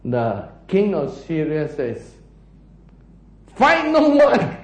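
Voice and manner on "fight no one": high, loud royal order; acted battle command. King of Syria ordering his soldiers, commanding.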